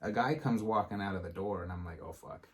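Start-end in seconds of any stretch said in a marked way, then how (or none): 2.0-2.5 under-breath curse. Retold or imitated. Guy walks out toward him.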